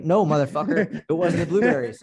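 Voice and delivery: put-on heated Boston-accented protest. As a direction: let the Boston accent out, loudly. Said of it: "that's your Boston accent coming out".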